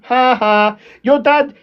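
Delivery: ordinary talk, nothing marked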